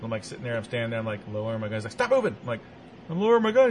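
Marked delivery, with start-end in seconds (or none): 1.9-2.4 higher sharp command; tense uncle voice; uncle's warning. Retold or imitated. Uncle warning about rattlesnake. 3.1-3.7 higher, louder anxious reply; nervous childlike protest; scared kid. Retold or imitated. Scared kid near rattlesnake.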